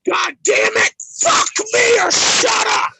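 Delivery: crying out in rage; anguished yell. Real, not acted. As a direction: break into a tearful yell. Drunken furious stream fight.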